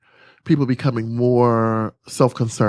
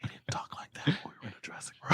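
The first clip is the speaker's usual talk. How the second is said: fast laughing whisper; whispered aside; 19 dB quieter, faster than usual. Real, not acted. Explaining the dressing room, whispering fast. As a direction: rush it in a whisper. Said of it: "he talks like this".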